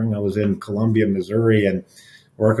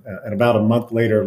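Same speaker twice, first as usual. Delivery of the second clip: ordinary talk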